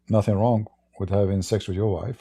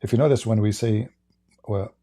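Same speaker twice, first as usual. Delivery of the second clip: ordinary talk, nothing marked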